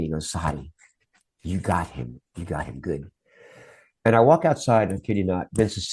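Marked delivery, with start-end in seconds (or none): 0.3-3.1 low, softer retold aside; imitated hushed verdict. Boss's son to the spot's creator, imitated low hushed verdict. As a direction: lightly imitate someone confiding, dropping low and quiet.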